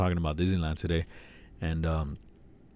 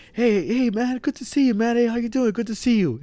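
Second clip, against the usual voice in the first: a much higher, breezy passing greeting; mimicking Angel; 14 semitones higher than usual. Maintenance worker greeting in passing, high mock-cheery voice. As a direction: toss off a quick cheerful hello while walking by. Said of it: "He was like".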